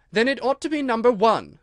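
high, loud comeback; triumphant retort. Acted. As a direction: score the point against the king. Alice argues with the king.

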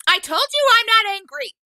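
loud high yell of denial; angry shout. Acted. Boy yelling at the old woman that he isn't angry. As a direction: shout it at her, losing control.

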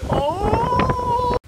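high loud oh; surprised yelp. Real, not acted.

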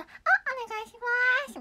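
high odd mimicry; weird player voice. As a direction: squeak it like a strange player. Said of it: "やばいやつみたいなやつ".

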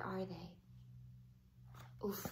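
ordinary delivery

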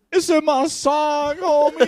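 slow, stretched mock wail; crying. Suspect revealing where it is, mock crying. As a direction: whine it out in fake tears. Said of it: "He just started crying".

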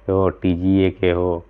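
ordinary talk

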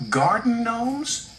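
high, slow, rising question; incredulous high-voiced little engine; surprised question. A narrator's reading. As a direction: echo it in puzzled surprise. Percy echoes in surprise.

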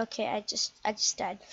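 normal talking voice

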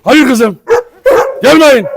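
loud commands; shouting at the dogs. Real, not acted. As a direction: yell at them to stay back. Stopping dogs approaching.

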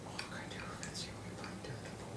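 fast whispered Irish accent; whispered Irish pub invitation. Shown as a demonstration. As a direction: whisper the Irish line to yourself. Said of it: "Are you talking to yourself?".

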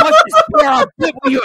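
very high, loud falsetto plea; squealed mock Anakin. Mocking Anakin offering Padme the pear, shrill loud falsetto. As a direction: shriek it like a desperate lover. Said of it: "he's like".

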